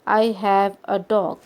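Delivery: very slow, each word spelled out for learners; slow example sentence. Shown as a demonstration. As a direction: read it out word by word like a teacher. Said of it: "For example". (धीमा उदाहरण वाक्य, हर शब्द अलग)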